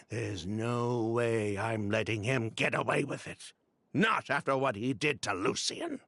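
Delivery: normal talking voice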